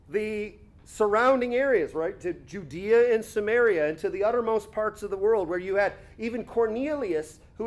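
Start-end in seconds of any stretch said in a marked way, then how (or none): none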